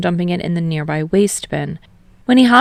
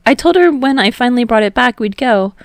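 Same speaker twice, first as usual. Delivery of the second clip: put-on higher, louder boy; Hinata, higher voice; 7 semitones higher, 7 dB louder than usual. A narrator's reading. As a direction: voice Hinata up high.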